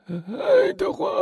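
slow, very high, faltering call; stammering. A narrator's reading. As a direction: stammer the child's name in dread. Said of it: "他口吃地说". (恐惧得结结巴巴地呼唤)

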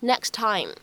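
"next time" with the t of next dropped, run together; linked next time. Shown as a demonstration. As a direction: say "next time" as nex-time with no release. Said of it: "可以直接读成". ("next time" 失去爆破连读)

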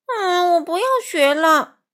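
high, loud whiny wail. A narrator's reading. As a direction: wail it out like a child giving up. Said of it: "小格哭喊着". (孩子气地高声哭喊)

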